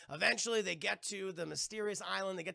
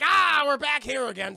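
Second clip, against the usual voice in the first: hammed-up villain; 9 semitones higher, 12 dB louder than usual. Barbossa's hammy return.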